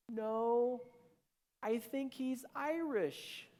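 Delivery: high, drawn-out mock-tearful voice. Wife tells husband through tears, imitated tearful voice. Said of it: "she's got tears".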